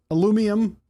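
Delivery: "alumium" as a-LOO-mee-um, high; high "alumium", stressed on LOO; variant pronunciation. Shown as a demonstration. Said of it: "Those are all the same thing".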